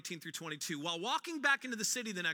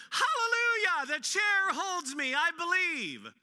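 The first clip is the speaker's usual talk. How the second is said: much higher, louder, a mock hallelujah cry; hammed-up joyful shout; 8 semitones higher, 6 dB louder, slower than usual. Preacher mocking proof-based faith, loud exultant cry. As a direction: shout it like a comic revelation from the pulpit. Mock joy at chair holding.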